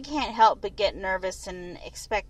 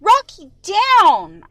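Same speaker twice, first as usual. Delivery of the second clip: loud, higher yell at the dog; 9 semitones higher, 12 dB louder, much slower than usual. A narrator's reading. Owner yelling at the dog a second time, loud. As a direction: shout it so the dog finally listens. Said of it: "I yell again".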